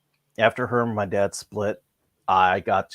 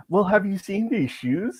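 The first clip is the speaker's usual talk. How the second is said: much higher, prissy schoolgirl; girly schoolgirl voice; 11 semitones higher than usual. Retold or imitated. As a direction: lift it way up like a shoe-obsessed girl. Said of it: "she's like".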